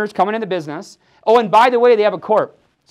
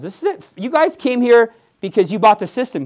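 ordinary talk, nothing marked

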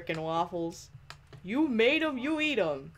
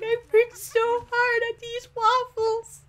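much higher, slow pitiful whine; whiny Freddy voice; 13 semitones higher, 3 dB louder than usual. Retold or imitated. Player voicing Freddy over his waffles, pitiful whine. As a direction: whimper it like a sulky kid. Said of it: "He's like".

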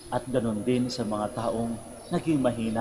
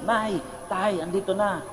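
imitated calling out; 8 semitones higher than usual. Child calls mother and father home, imitated high-pitched shout. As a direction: call to parents in a raised pitch. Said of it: "sumisigaw yung anak".

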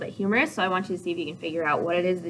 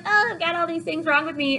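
whiny complaining; 8 semitones higher than usual. Retold or imitated. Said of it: "the nurse is like".

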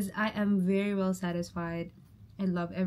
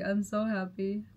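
usual speaking voice

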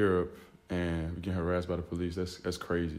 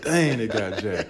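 slow, louder, laughing quote; laughing retell; 7 dB louder, slower than usual. Retold or imitated. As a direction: savor the line with a laugh. Teammate retold, laughing.

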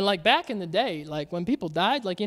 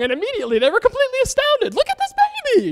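much higher, fast mock amazement; mock astonishment; 3 dB louder, much faster than usual. Readers amazed at a walking baby, high mock gush. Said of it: "'Cause you could have gotten it wrong".

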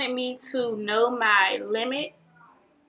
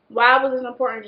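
louder, calling to a friend; 7 dB louder than usual. Real, not acted. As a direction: ask the friend out loud.

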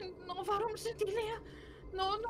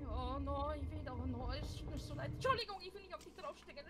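ordinary talk

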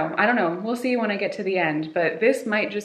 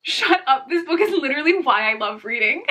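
higher, louder, laughing through tears; blurting out joy after crying; overwhelmed exclamation; 7 semitones higher, 5 dB louder than usual. Real, not acted. Overwhelmed by the book ending.

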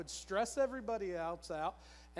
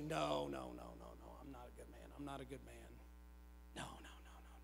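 far softer, lower murmured retold refusal; hushed denial; 8 semitones lower, 11 dB quieter than usual. Husband turning down his wife's praise, hushed denial. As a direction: brush the praise off under your breath.

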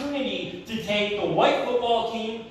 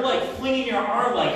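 ordinary delivery

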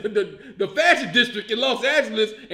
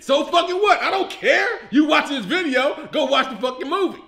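defiant outburst; 5 semitones higher, 4 dB louder than usual. Real, not acted. Defying critics of the movie.

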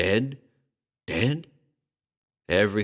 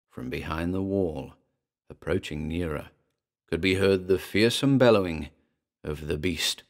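plain reading voice

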